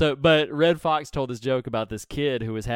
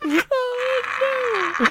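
usual speaking voice

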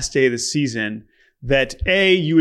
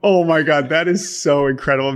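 ordinary delivery

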